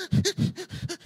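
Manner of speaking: high, loud sobbing gasps; imitated child's sobbing. Dad imitating his son crying in a hug, sobbing. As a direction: sob like a child being comforted. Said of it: "he was just going".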